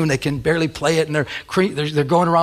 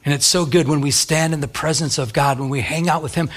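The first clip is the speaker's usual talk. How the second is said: ordinary talk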